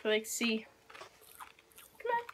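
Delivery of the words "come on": coaxing. Real, not acted. Coaxing the other on.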